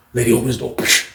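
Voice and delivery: ordinary talk, nothing marked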